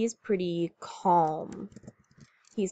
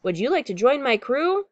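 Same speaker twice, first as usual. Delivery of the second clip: higher, louder storybook question; captain's eager invitation; 5 semitones higher, 5 dB louder than usual. A narrator's reading. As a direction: invite a new friend aboard eagerly. Captain calls to monster.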